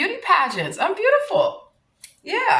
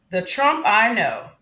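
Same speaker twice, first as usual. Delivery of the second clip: usual speaking voice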